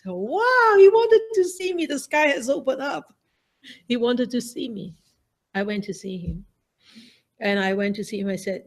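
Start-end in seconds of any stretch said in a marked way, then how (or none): 0.1-3.1 much higher, bursting with delight; overjoyed. Retold or imitated. Nun overjoyed her brother wants to see her, much higher. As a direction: gasp it out like the sky just opened.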